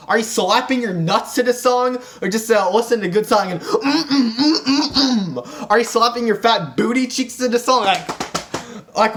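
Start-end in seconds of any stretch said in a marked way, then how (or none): 3.6-5.7 hammed-up rhythmic grunting. Imitating someone listening to a good song, exaggerated grunts. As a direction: grunt along as if hammering out a beat.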